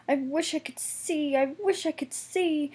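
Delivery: high plaintive complaining. A narrator's reading. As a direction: moan it like a frustrated little engine. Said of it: "he complained".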